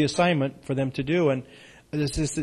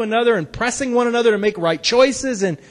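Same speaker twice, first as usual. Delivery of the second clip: raised, fervent preaching; 8 semitones higher, 4 dB louder than usual. Acted. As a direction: preach it with passion, loud. Preaching sermon.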